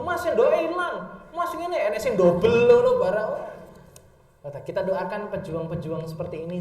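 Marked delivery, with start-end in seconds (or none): 0.0-3.3 customer complaining. Retold or imitated. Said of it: "jo dipaido".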